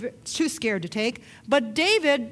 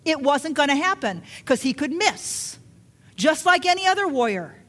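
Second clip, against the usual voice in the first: louder, higher, building fervent preaching; preaching at full force; 4 semitones higher, 5 dB louder than usual. Acted. Pastor driving home to the congregation, rising fervent preaching.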